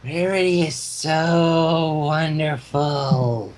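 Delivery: very slow, flat-toned croaking line; toneless croak. A narrator's reading. Entranced Lyra croaking her praise, slow toneless croak. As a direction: croak it out like someone in a trance. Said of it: "in a weird, toneless croak".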